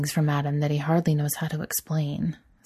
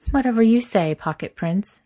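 dismissive; 6 semitones higher, 5 dB louder than usual. Acted. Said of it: "dismissing him with a wave".

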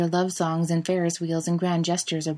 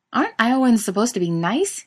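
complaining; 5 semitones higher, 5 dB louder than usual. Acted. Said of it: "he complains".

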